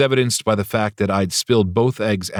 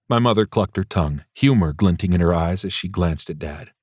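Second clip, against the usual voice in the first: ordinary delivery, nothing marked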